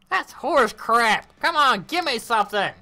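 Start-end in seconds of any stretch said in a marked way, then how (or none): none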